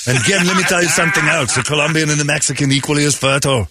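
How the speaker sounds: comic Arnold impression, Austrian accent; Arnold Schwarzenegger Austrian accent. Former governor tells of California, Arnold-style Austrian accent. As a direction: ramble on in a put-on Arnold accent. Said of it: "have to do the accent".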